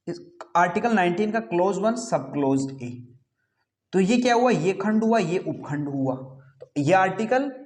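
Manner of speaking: usual speaking voice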